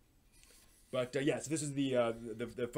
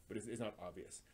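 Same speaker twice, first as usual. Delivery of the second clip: ordinary delivery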